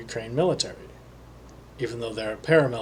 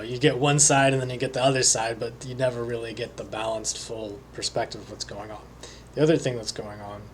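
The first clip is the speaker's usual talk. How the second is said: ordinary talk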